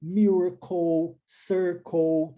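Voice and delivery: "Miracle" and "circle" ending in a dark "ou" sound; final -le as "ou". Shown as a demonstration. Teacher showing the "ou" sound of final L E. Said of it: "pronúncia ou".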